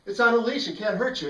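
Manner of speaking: high, loud call after someone. Retold or imitated. Young storyteller calling out to Joe, high and loud. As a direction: shout it across to the fleeing kid.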